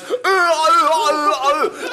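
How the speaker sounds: slow, high inarticulate groaning; drawn-out mimicked moans; mocking groans. Comic imitating a customer at a shop, mocking groans. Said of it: "he goes".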